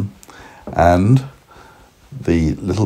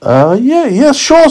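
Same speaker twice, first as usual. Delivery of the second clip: high, hesitant nervous carrot; a bit nervous; 5 dB louder, slower than usual. Acted. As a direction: agree nervously in a squeaky voice. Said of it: "a bit nervously".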